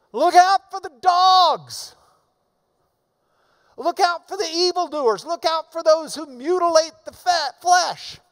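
booming scripture reading; preaching at full force. Acted. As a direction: thunder the warning out. Preacher reads Paul's warning.

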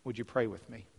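ordinary talk, nothing marked

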